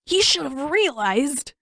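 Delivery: narrated brother getting shrill with worry; voice sliding upward. A narrator's reading. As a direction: let the voice climb as he complains. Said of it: "Sokka's voice slid upward".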